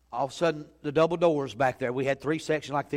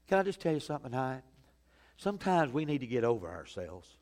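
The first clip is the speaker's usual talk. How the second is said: ordinary talk, nothing marked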